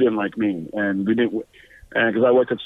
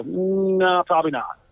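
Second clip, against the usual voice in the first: doubtful dismissal; 7 semitones higher, slower than usual. Retold or imitated. Weighing up John's claim.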